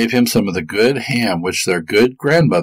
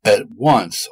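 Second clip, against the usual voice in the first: ordinary delivery, nothing marked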